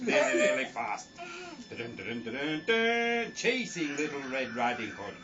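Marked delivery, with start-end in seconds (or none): none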